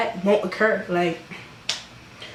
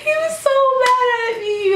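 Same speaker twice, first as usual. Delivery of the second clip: very high, laughing squeal; squealing with amusement; 3 dB louder than usual. Real, not acted. Vlogger recalling how mad he was, high amused squeal. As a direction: crack up while recalling it, voice shooting up. Cracking up recalling his anger.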